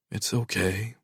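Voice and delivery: calm your frightened daughter softly; soothing. A narrator's reading. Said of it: "I soothe".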